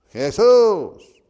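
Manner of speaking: very loud, high drawn-out yell. Retold or imitated. Blind man calling Jesus over the crowd, shouting harder. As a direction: bellow the name with a long held vowel. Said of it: "Y él gritaba más".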